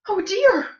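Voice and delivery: high, loud little squeal of dismay; wee little Baby Bear voice. A narrator's reading. Baby Bear sees his chair, wee little voice. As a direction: squeak it as a tiny upset cub. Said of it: "in his wee little voice".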